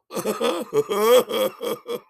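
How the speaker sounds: high, loud ha-ha-ha; wailing, high-pitched laughter; helpless hooting laugh. Retold or imitated. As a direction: break into mimicked drunken hysterics. Acting friend's reaction to crash.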